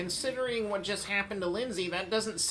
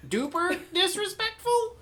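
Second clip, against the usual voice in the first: rising disbelief; 14 semitones higher, 5 dB louder than usual. Acted. Escalating mock question.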